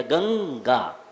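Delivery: गंगा slowly, low, with a held ng; class nasal. Shown as a demonstration. Teacher demonstrating the fifth letter, class nasal. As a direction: linger on ng in गंगा before the final gaa. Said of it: "यहाँ का प्रयोग किया जाएगा".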